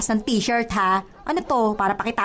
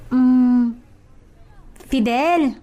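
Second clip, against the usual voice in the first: usual speaking voice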